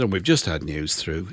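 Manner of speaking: low, soft, mournful radio announcement; lugubrious. Retold or imitated. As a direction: break sad news like a gloomy DJ, no accent. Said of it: "in his lugubrious tones".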